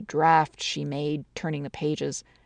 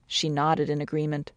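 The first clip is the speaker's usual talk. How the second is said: plain reading voice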